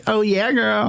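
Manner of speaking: put-on high sassy "oh yeah girl". Imitating someone he watched, high and sassy. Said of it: "I watched somebody do this the other day".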